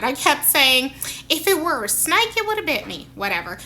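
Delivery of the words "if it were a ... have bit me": put-on higher-pitched joking Southern accent; silly Southern impersonation. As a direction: play up a Southern woman's twang. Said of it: "impersonation and stuff and just being silly".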